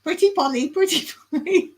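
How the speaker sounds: high-pitched parrot voice; playful high parrot imitation. Shown as a demonstration. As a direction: squawk it like a pet parrot.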